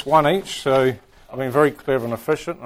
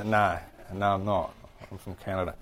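lower, softer mock-weary correction; mildly weary Canadian; 4 semitones lower, 9 dB quieter than usual. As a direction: quietly correct a stranger's mistake, a touch resigned. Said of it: "And you go".

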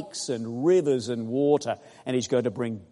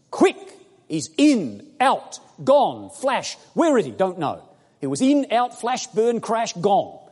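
loud, high staccato bursts; quick punched words; punchy excited bursts; 6 semitones higher, 5 dB louder, slower than usual. Real, not acted. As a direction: fire each word like a flash. Rapid-fire vivid illustration.

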